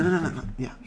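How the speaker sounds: ordinary delivery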